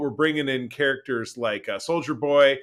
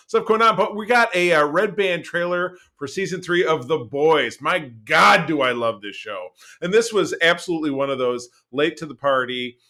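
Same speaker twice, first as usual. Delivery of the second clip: ordinary talk, nothing marked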